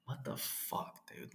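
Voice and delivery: breathy whispered what the fuck; whispered disbelief. Retold or imitated. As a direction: mutter it in stunned disbelief under your breath. Stunned reaction, said under breath.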